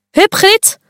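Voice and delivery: cheering call. Acted. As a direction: shout encouragement from the side. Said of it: "roept Murmeltje".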